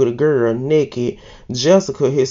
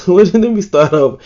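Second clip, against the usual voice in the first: laughing through the words, louder; 4 semitones higher, 5 dB louder than usual. Real, not acted. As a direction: crack up after the slip and restart. Laughs at own slip.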